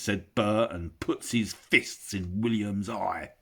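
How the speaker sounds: usual speaking voice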